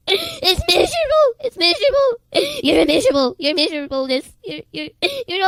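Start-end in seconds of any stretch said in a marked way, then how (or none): 0.1-1.3 high, slow, cracking with tears; voice breaking. Real, not acted. Warning of hell, voice breaking. 1.4-2.6 high, slow tearful repeat; voice breaking. Real, not acted.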